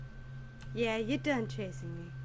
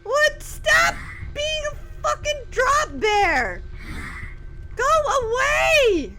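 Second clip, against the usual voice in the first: panicked screaming; 13 semitones higher, 9 dB louder, slower than usual. Real, not acted. Scared by drop bear.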